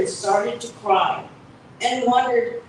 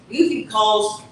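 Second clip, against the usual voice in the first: ordinary delivery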